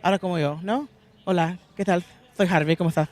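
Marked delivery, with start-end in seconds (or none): 1.2-3.1 a light Castilian Spanish accent on the greeting; Castellano accent. Shown as a demonstration. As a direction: say the greeting like someone from Madrid. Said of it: "that was my Castellano accent".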